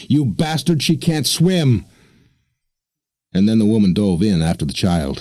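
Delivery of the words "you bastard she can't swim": high, slow, desperate yell. Acted. Woman yelling at mariner as the girl thrashes, high slow yell. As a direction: hurl it down at him from the side. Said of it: "and yelled".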